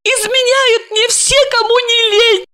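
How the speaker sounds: put-on high drunk sobbing woman; drunk crying. A narrator's reading. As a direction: wail it tipsy and tearful. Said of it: "пьяно рыдала Ася".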